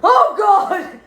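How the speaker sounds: high, loud startled shriek; mock-terrified cry of Oh God; shriek of fright. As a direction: scream as if you just saw a monster. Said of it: "I'm like".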